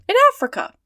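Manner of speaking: high, loud amazed exclamation; astonished. A narrator's reading. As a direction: burst out in disbelief. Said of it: "repeated Passepartout".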